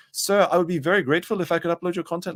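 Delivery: high, pleading mock Indian accent. Uploader asking the streamer to allow his copying, high Indian accent. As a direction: beg politely in an exaggerated Indian accent. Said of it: "very indignant, uh, Indian man message me".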